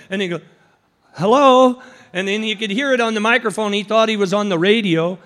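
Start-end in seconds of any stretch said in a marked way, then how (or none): 1.2-1.8 loud hello. Acted. Passerby speaking into announcer's microphone.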